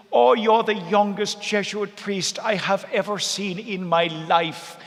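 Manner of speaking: a hint of an emotional elderly relative; Mary's voice. Mary greets him in tears.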